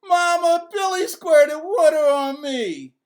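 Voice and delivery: loud high outraged cry; screaming. A narrator's reading. Soaked boy screaming to his mother, loud and high. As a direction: scream for mama's help. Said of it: "jumped out of bed screaming".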